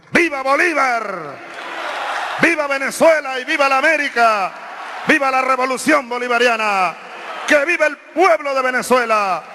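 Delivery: ranting dictator speech. Real, not acted. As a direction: fire up the crowd with vivas. Crowd cheers closing a speech. (discurso encendido a gritos)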